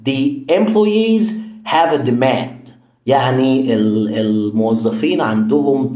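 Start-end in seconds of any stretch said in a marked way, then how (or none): 0.1-2.7 The employees have a demand, drawn out very slowly; slow example. Shown as a demonstration. Teacher reading the example slowly. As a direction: spell out the example for learners. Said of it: "يعني مثلًا".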